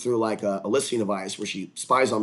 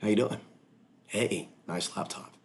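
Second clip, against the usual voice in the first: creepy voice; 3 semitones lower, 8 dB quieter than usual. Retold or imitated.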